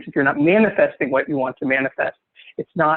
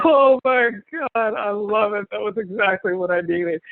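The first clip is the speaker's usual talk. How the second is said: laughing delight; 6 semitones higher than usual. Real, not acted. Laughing at glitter farts joke.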